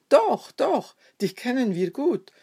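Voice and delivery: high, loud insistence easing off; crowd calling out. A narrator's reading. Bystanders surrounding Peter, calling out accusingly. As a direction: pounce on him with an accusing call. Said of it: "umringten Petrus und riefen".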